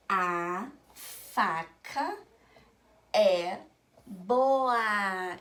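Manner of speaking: very slow, long pauses, stretched vowels; reading word by word. Shown as a demonstration. Teacher reading a written sentence to children, word by word. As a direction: drag out each word, "é" and "boa" loud. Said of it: "quando eu leio de sílaba em sílaba".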